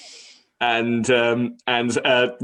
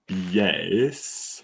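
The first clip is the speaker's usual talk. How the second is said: furtive yes; 3 semitones lower, 5 dB quieter than usual. Acted. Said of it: "looks furtively around and goes".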